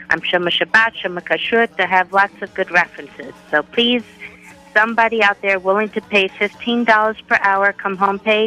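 normal talking voice

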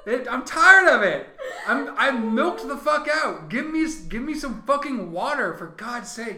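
high, loud mock-desperate rant; exasperated plea. Milked-out viewer demands water, high exasperated pleading. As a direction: beg loudly, fed up.

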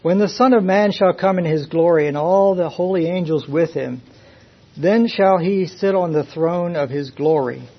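loud, ringing pulpit reading; full-force preaching. Acted. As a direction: proclaim it to the whole congregation.

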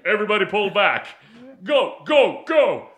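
loud movie-style order; hammed-up loud command; mock Tyson yell. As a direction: shout the retreat order, a bit hammed up. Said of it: "You got a sore throat?".

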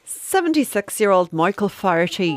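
put-on whispered Irish accent on a name; hushed mock Irish storyteller. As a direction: breathe the name out in an Irish lilt. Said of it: "We've gone straight into an accent".

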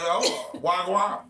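mock Jamaican greeting. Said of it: "He like".

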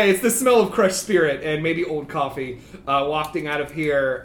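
ordinary delivery

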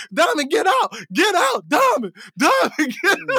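high-pitched mock yell at the TV; retold yelling. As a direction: yell a half-laughing warning at the screen. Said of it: "I'm yelling at the T V".

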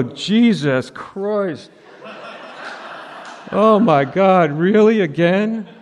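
high, drawn-out mock exasperation; comically hammed-up disbelief; exasperated. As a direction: play the weary parent at wit's end, stretched out. Acting exhausted parent woken again at night.

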